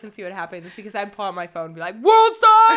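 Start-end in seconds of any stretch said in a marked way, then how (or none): 2.0-2.8 high, very loud yell; fight-video shout. Pretend. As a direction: holler it like cheering on a street fight. Filming a fight, calling out.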